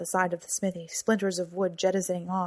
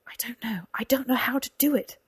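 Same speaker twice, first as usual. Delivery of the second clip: higher, breathy, helpless protest; flustered, rising admission of helplessness; panicked protest; 5 semitones higher than usual. A narrator's reading. Cora panics, asked to heal.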